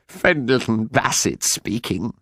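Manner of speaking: slow, tearful phone greeting; sobbing. A narrator's reading. Farmer answers the phone while still heartbroken, slow sobbing voice. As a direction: answer slowly through theatrical sobs. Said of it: "still sobbing broken-heartedly".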